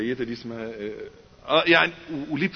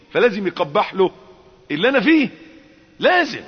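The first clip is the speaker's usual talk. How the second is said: loud, high fervent sermon; impassioned loud preaching; preaching at full force; 8 semitones higher, 6 dB louder than usual. Acted. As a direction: hammer the point home to the crowd.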